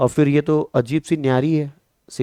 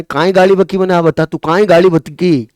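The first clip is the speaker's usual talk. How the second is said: 1 mock Rajasthani quarrel; 4 semitones higher, 7 dB louder than usual. Teacher mimics village dialect quarrel.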